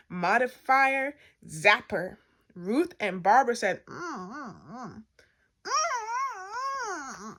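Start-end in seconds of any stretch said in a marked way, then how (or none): 3.9-7.4 soft closed-mouth humming, rising to high whimpers; gagged wordless mumbling; muffled protest. A narrator's reading. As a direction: try to talk through a mouthful of mattress. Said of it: "They couldn't even talk".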